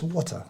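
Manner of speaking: "Water" said softly with a British non-rhotic ending; soft British "Water", final r dropped; British-accented water. Retold or imitated. Quoting British actress on her kids' speech, British-accented water. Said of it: "she says".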